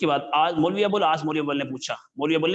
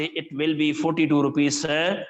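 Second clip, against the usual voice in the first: slow careful answer; much slower than usual. Retold or imitated. Tailor tells the Maulvi the price slowly, gently. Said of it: "میں سلو سلو کر کے بتایا".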